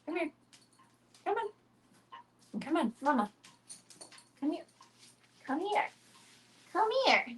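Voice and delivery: high, soft coaxing voice; high soft coaxing of her dog; pet talk. Real, not acted. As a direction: coax the dog over gently. Calling dog to clean eye.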